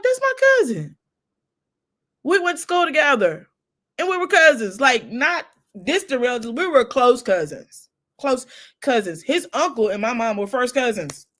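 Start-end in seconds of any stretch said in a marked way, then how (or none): 0.0-9.2 astonished. Retold or imitated. Woman realizing a schoolmate is her cousin, high and astonished. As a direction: exclaim the discovery.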